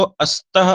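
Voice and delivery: अस्तः with an extra 'a' before the s; wrong added vowel. Shown as a demonstration. Teacher showing a common pronunciation mistake, wrong added vowel. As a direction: say अस्तः starting on a short 'a'. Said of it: "जो गलती होती है उच्चारण में".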